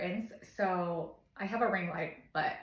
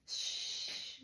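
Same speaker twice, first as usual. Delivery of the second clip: long soft voiceless "shh"; soothing shush; 6 dB quieter than usual. Shown as a demonstration. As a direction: shush a baby to calm it.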